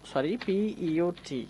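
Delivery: ordinary delivery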